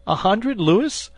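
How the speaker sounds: amused. A narrator's reading.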